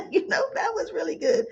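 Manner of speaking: laughing through the words; giggle, then say it fast. Real, not acted. Host laughing with her viewers about the good scene.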